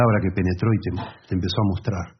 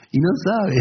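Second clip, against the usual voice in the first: amused; 10 semitones higher, slower than usual. Real, not acted. Comenta con tono burlón.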